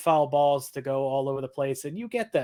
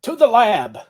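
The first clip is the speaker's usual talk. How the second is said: loud, higher dramatic call; dramatic announcement; 6 semitones higher, 9 dB louder than usual. Acted. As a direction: announce it like a TV promo. Dramatic echo of the show.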